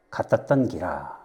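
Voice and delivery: slow, low Gyeongsang drawl; half-managed Gyeongsang dialect quote. Acted. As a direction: draw out the Gyeongsang ending, low and soft. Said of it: "사투리를 잘 구사를 못했는데".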